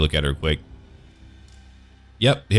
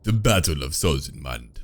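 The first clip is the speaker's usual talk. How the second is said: low dramatic announcement; 7 semitones lower, 6 dB quieter, slower than usual. Acted.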